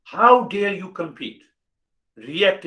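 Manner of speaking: emphatic how dare. Real, not acted. Teacher rebuking listeners' habits, loud and high. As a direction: hit the rebuke hard. Repeated rhetorical scolding.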